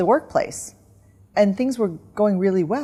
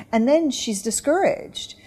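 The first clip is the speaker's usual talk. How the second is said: usual speaking voice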